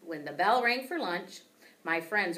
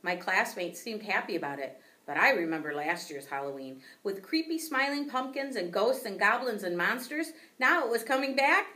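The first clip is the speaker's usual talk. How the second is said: ordinary delivery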